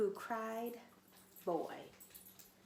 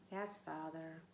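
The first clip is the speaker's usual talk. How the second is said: lower, softer grudging mutter; 5 semitones lower, 7 dB quieter, slower than usual. Acted. Little wolf grudgingly answering his father. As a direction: mumble it under your breath. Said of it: "Little Wolf muttered".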